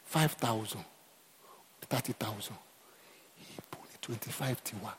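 whispered numbers; awed whisper. Real, not acted. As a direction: hush it to a whisper. Drops to whisper.

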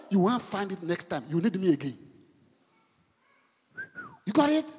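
normal talking voice

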